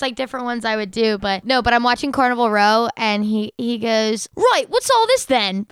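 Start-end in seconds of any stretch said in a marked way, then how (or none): 4.4-5.7 high, drawn-out British bobby-style line; slow mock-British query; British accent. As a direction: imitate a British accent, pitched up. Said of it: "I love when British people say that".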